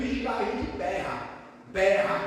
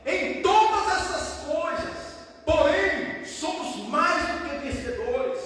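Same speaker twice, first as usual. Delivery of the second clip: high, slow, emphatic proclamation; declaimed scripture; 8 semitones higher, 4 dB louder, slower than usual. Acted. Preacher declaring believers more than conquerors, declaimed scripture. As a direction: proclaim it slowly with a loud ring.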